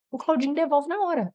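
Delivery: ordinary delivery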